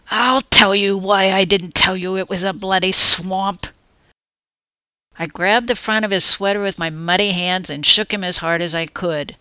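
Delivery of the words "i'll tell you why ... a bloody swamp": louder, seething comeback; angry retort. Acted. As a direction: give it back through clenched fury. Furious narrator fighting back.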